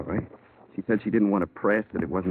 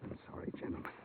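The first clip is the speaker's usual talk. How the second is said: whispered apology; 19 dB quieter, faster than usual. Acted. Doctor breaks down after confession.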